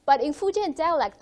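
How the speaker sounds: usual speaking voice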